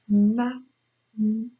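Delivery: "magnifique" slowly split as ma, gni, fique; slow, syllable-by-syllable "magnifique"; showing the ñ sound. Shown as a demonstration. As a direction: sound out "magnifique", setting apart the gn as ñ. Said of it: "vous reconnaissez ici le son ñ".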